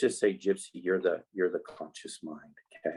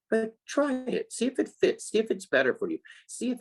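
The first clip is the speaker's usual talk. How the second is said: coaxing; 14 semitones higher than usual. Acted. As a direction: sing it up high to tempt them. Coaxing children to try ideas.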